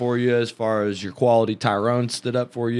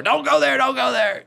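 a hammed-up warning pitched way up; mock warning; 12 semitones higher than usual. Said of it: "I've tried to hold you back before".

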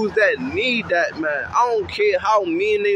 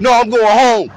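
louder, higher, firm no; a kid refusing to go back, raised voice; kid protesting; 5 semitones higher, 7 dB louder than usual. Retold or imitated. Kid tells big bro he's going home, louder and higher.

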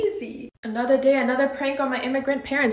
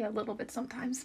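ordinary talk

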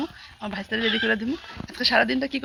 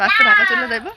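higher and louder, a sudden catch of tears; fighting back a cry as he says it; voice breaking; 9 semitones higher, 8 dB louder than usual. Real, not acted. Nephew leaving, voice breaks. (কান্নাভেজা ভাঙা গলায়)

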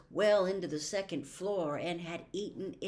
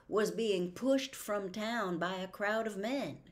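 ordinary talk

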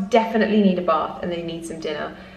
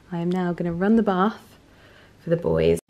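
ordinary talk

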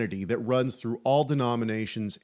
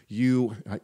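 ordinary talk

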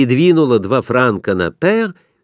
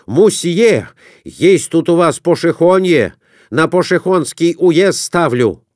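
loud, higher, insistent; loud merchant; 7 semitones higher, 3 dB louder than usual. A narrator's reading. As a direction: push the bet on the croupier. Merchant demanding at table.

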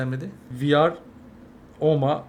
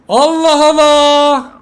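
slow, loud, drawn-out exasperation; exasperated shout; 9 semitones higher, 9 dB louder, much slower than usual. Real, not acted. Gamer losing patience with the team, slow exasperated shout. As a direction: drag it out in disbelief.

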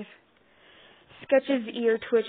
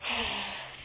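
heavy breathy sigh; reluctant sigh; 6 dB quieter than usual. A narrator's reading. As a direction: sigh out in giving in. Reluctantly giving in to a demand.

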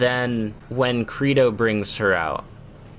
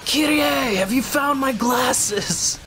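high, hammed-up yelling over trivia; mock anguished scream, pitched way up; Nero, intensely dramatic; 14 semitones higher than usual. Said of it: "always intensely dramatic Nero".

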